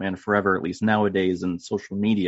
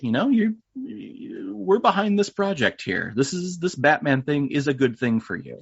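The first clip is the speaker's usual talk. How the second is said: imitating a supportive Alfred, pitched up high then easing down; supportive butler; 7 semitones higher than usual. Imitating Alfred backing Batman's project, supportive. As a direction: put on a high-pitched voice of loyal encouragement. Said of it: "is kind of always there, being like".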